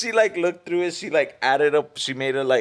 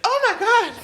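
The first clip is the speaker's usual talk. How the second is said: mock gasp. Examiner panics at speeding car.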